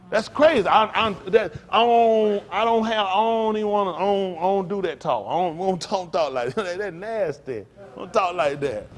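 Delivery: disgusted outburst. Real, not acted. Reacting to disgusting talk.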